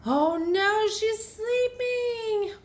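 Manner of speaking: high, slow dramatic oh no; hammed-up shock for the kids; dismay. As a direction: stretch it out in mock alarm. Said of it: "reads aloud to children".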